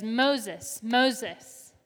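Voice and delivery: God calling to Moses from the bush, loud calling; divine call. Acted. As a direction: ring the name out across the desert. God calls from burning bush.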